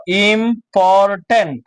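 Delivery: important very slowly as im, por, tent; teacher breaking important apart; separate syllables. Shown as a demonstration. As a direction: give each syllable of important its own beat. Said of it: "আলাদা আলাদা করে উচ্চারণ করবা".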